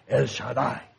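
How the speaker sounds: mock-mysterious name. Young people naming their group, mock mysterious. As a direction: say it as an impressive mystery. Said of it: "Como misterioso y que wow".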